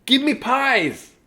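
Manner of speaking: put-on high, slow kid demand. Samuel asks the pie contest for pies, high and slow. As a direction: drag out the demand like a greedy kid. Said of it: "Samuel ... said".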